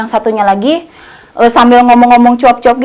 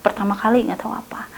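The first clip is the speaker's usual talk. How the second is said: whisper; 2 semitones lower, 10 dB quieter, faster than usual. Real, not acted. Unvoiced low line.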